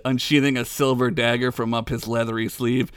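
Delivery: usual speaking voice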